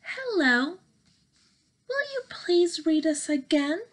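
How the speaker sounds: ordinary delivery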